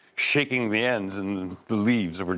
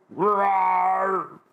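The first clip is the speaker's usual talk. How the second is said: high, loud roar let out in sheer panic; scared man's roar; 9 semitones higher, 4 dB louder than usual. A narrator's reading. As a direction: roar without thinking, out of fright. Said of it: "he goes".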